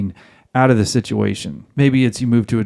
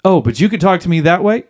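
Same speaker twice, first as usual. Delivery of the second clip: higher mock-indignant question; challenging retort; 5 semitones higher, 4 dB louder than usual. Wife baiting husband into a fight, indignant retort. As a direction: bait with an accusing question. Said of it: "She's going to hit you with all those questions".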